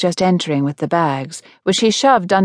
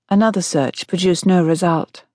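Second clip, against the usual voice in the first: ordinary delivery, nothing marked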